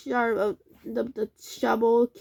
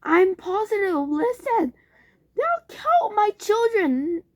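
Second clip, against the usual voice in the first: high, loud desperate cry; panicked father; frantic; 11 semitones higher, 5 dB louder than usual. A narrator's reading. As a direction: plead in terror.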